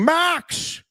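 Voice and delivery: high-pitched, hammed-up cry of a name; mock scream. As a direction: call it out in a silly impression voice. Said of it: "looking in the defender's face and screaming".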